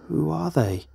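uneasy questioning; anxious question. A narrator's reading. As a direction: ask it anxiously, voice lifted. Narrator questions the child's voice.

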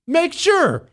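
a host mock-chewing out his producer for ruining the queso; mock outraged scolding. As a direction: scold him like a betrayed chef. Scolding Michael over Ritz crackers.

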